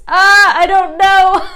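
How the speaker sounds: loud, high cry of confusion; exasperated wail. Real, not acted. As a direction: wail it out, flustered and helpless. Flustered outburst over the pronunciation.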